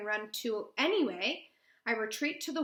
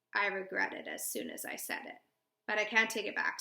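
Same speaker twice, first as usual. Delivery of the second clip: plain reading voice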